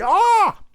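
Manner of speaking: high, loud cringing yelp; pained wince cry. Shown as a demonstration. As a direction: shriek as if teeth hit metal. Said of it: "Like with your back teeth".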